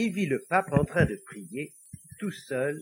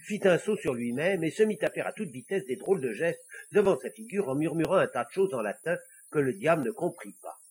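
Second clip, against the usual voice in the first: ordinary delivery, nothing marked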